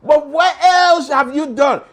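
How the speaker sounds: high, loud and slow, grudging then demanding; concede, then press him hard; grudging crowd. Retold or imitated. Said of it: "They said".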